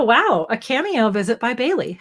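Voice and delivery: normal talking voice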